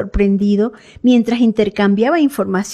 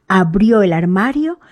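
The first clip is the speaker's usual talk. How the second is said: ordinary delivery, nothing marked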